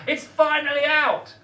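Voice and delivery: high, triumphant yell; great shout. Acted. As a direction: belt it out as the tooth comes free. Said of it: "grunted and gave a great shout".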